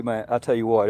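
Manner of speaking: ordinary delivery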